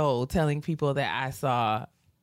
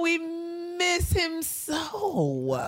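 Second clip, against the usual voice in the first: mock mourning; 12 semitones higher, much slower than usual.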